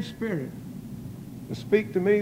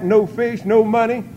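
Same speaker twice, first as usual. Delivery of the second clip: usual speaking voice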